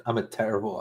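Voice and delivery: ordinary delivery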